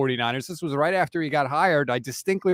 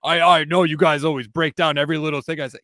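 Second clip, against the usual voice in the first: gruff coach; 4 dB louder than usual. Retold or imitated. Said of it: "He gets gruff".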